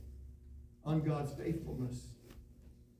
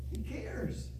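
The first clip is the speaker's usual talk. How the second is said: whispered, tender aside; hushed reassurance; 5 semitones higher, 5 dB quieter than usual. Acted. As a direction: breathe it to them softly. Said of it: "because He cares for you".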